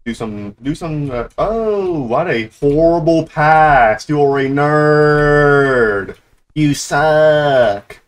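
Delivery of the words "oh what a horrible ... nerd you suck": loud, slow jeering; drawn-out taunt. Real, not acted. Gamer mocking a horrible pass, drawn-out taunt. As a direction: stretch out the insults loudly.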